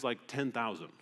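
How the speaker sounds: usual speaking voice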